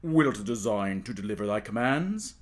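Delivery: low, formal courtier's voice; a courtier in the dark offering service, low and formal; dim voice. Acted. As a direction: speak low and deferential from the dark. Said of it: "and a voice said".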